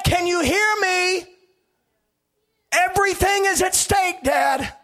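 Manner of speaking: high, loud pleading cry; frightened child shouting. Acted. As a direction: yell for dad like a terrified son. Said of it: "screaming in my ear".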